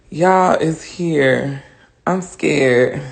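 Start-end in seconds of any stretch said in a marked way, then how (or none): none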